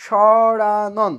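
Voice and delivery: slow, loud read-out. Shown as a demonstration. Teacher reading the quiz word out to the class, slow and loud. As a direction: drag সরানন out slowly and loudly for the class.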